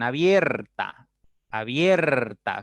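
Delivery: "abierta" stretched on the stressed "bier"; drawn-out diphthong demonstration. Shown as a demonstration. Teacher showing students the opening mouth, "abierta" drawn out. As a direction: say "abierta" slowly, holding the middle syllable. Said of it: "Fíjense, la palabra abierto".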